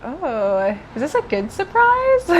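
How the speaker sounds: high questioning tech voice; accented tech. Retold or imitated. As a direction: ask it brightly, high. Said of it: "this woman is like".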